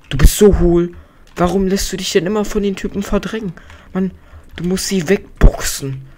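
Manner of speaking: high, loud exasperated rebuke; exasperated scolding. Real, not acted. Player scolding the villager who gets pushed around, exasperated. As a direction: pitch it up and berate him.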